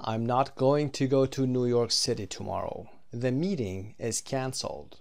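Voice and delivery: slow, clear example sentences; slow model reading. Shown as a demonstration. Teacher reading the negative example, slow and clear. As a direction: read the example out slowly. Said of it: "teacher models example".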